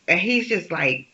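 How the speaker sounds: ordinary talk, nothing marked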